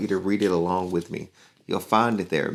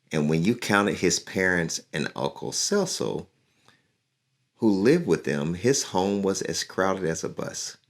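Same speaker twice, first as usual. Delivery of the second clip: lively storybook reading; reading to children; 2 semitones higher than usual. A narrator's reading. Teacher reading a picture book to her class, lively. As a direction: read the story aloud to young listeners.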